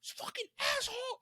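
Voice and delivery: very high, held-back mock scream; slow, strained yell at reduced volume; imitated angry yelling. Friend hit in the eye yelling in the alley, mock scream. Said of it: "starts yelling in the alley".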